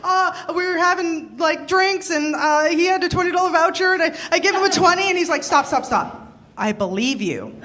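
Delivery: flustered over-apologizing Canadian; Canadian apology mode. Retold or imitated. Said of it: "Canadian apology mode activated".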